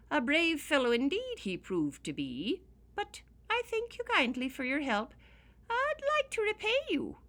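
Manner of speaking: high, loud little-man voice; a grateful leprechaun, pitched high. Acted. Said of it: "said the leprechaun".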